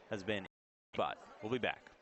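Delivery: ordinary delivery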